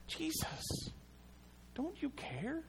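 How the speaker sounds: high, breathless whisper; whispered plea. Acted. Preacher voicing a spent believer, whispering to Jesus. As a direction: let the plea fall to a whisper.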